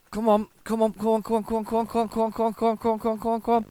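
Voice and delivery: rapid high repeated chant; strained machine-gun pleading; urgent urging. Real, not acted. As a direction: egg it on without stopping. Urging the game on while waggling.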